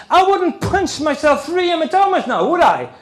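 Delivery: mock-indignant daughter; daughter's retort. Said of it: "my daughter says".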